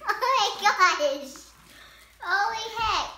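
helium voice. Real, not acted. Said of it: "Do I sound like a chipmunk now?".

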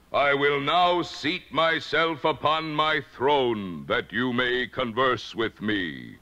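slow, stately, solemn wizard voice; solemn, all-powerful Oz. Acted. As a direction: proclaim it slowly to mere mortals. Disembodied Oz speaks to visitors.

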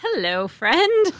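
slow, high sing-song hello; friendly greeting. Acted. Player greeting a tiny creature, slow and high. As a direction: greet the little creature slowly and sweetly.

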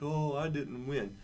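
slow, mopey complaint; selfish sulk. Retold or imitated. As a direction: pout like a sore loser. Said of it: "Don't be selfish and be like".

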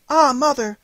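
put-on high, loud girlish protest; a little girl begging to be believed; pleading girl. A narrator's reading. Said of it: "answered Marie".

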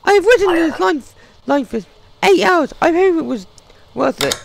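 voicing a traveller in a squeaky falsetto; high pitched traveller voice. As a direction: play the desperate traveller high up.

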